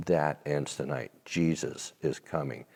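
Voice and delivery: soft, low, earnest; quietly firm promise; retold declaration. Preacher telling the waitress her trouble ends, softly. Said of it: "And I said".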